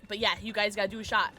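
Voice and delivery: normal talking voice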